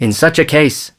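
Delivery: a touch of eager hope; hopeful. A narrator's reading. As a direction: venture it with mild hope. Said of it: "said the chief, hopefully".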